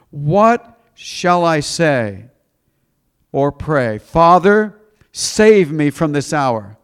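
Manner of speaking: loud, drawn-out plea from the pulpit; dramatic scripture reading. Acted. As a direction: cry the prayer out slowly to the congregation.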